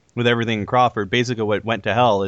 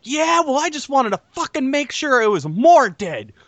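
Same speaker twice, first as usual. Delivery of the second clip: put-on higher, cursing kid voice; angry girl quote; 10 semitones higher than usual. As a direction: spit out the curses like an angry kid. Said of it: "And she's like".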